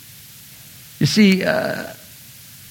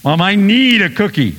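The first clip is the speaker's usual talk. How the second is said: put-on high, loud child begging; whiny kid; 10 semitones higher, 6 dB louder than usual. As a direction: beg like a child for a cookie. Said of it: "we're like kids".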